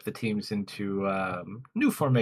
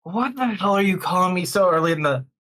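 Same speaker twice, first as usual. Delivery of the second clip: raised, irritated grumbling complaint; grumpy voice; 6 semitones higher, 5 dB louder than usual. Acted. Man behind the door called too early, grumpy. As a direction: complain loudly like someone disturbed too early. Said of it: "You just hear this grumpy voice".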